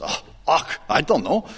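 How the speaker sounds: lightly imitated Scottish accent, opening on a breathy "och". Professor answering his pastor, in a mock Scottish accent. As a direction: put on a touch of Scots. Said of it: "He said it in that great Scottish accent".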